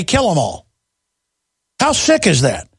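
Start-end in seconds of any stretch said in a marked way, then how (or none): 0.0-0.7 sinister mock quote. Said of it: "and then say".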